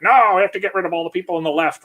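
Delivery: hammed-up, quick and shrill-free mocking whine; mocking partisans. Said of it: "They're like".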